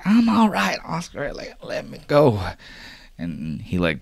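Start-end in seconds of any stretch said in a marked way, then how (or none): 0.1-2.4 put-on high, thin old lady voice; much higher, fussing old woman. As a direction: play a grandmother protesting a tight hug. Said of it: "hugs the old woman".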